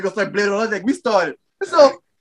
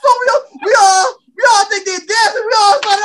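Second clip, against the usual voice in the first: laughing hard; 8 semitones higher than usual. Real, not acted. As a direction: tell it while laughing hysterically. Teller laughing through story.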